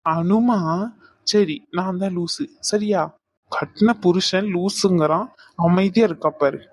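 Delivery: ordinary talk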